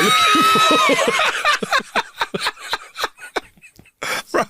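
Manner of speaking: laughing. Real, not acted. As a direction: crack up mid-sentence, shouting. Laughs at own punchline.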